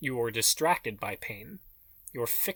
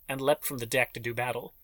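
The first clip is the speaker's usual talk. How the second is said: plain reading voice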